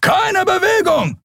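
very high, slow, drawn-out cry; shouted command. A narrator's reading. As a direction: cry it out as a guard's order, pitch way up. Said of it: "schrie ein Weißmantel". (hoch geschriener, gedehnter Befehl)

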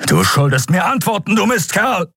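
high, furious yell; furious shout. Acted. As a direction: yell at the corpse. Telios shakes the dead Draxül. (wütend geschrien)

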